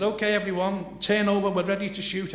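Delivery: film director calling out to the cast. Retold or imitated. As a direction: call the whole set to action.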